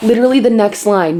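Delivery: normal talking voice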